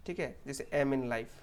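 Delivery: usual speaking voice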